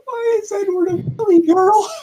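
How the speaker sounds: very high, squeaky disbelief; high, hammed-up squeal of confusion; squeaky mock voice. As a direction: shriek the question like a cartoon girl. Mocking confused misreading.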